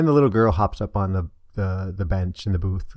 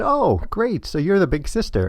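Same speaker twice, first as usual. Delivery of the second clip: put-on higher, cheerful voice for a small girl; bright to a child; 10 semitones higher than usual. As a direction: praise her like an excited grown-up. Man talks brightly to the child.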